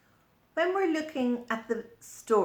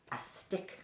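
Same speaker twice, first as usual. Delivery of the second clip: whispered; 9 dB quieter than usual. Pretend. Secret answer to children.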